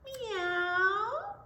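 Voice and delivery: high drawn-out meow; cat meow. Shown as a demonstration. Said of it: "Can you sound like a cat?".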